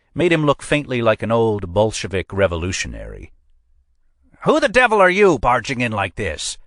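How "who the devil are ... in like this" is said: much higher, loud indignant outburst; furious at being barged in on; crying out. A narrator's reading. Old examiner yells at the intruders, high and loud. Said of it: "he cried".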